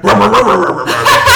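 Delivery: loud laughing barks, rising high; real dog barking. Retold or imitated. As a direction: bark wildly like an excited stray, laughing. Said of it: "and then I heard".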